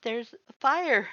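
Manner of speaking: high, trembling cry of alarm; frightened warning, voice trembling; trembling fear. A narrator's reading. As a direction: blurt it out shaking with fear. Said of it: "Your voice trembled as you spoke".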